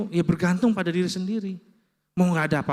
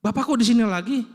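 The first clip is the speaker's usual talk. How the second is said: higher-pitched puzzled question, imitating a nurse; retold puzzlement; 6 semitones higher, 4 dB louder, faster than usual. Nurse to discharged patient still around, retold puzzlement. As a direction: act out a nurse's bafflement. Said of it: "Susternya yang rawat saya bingung".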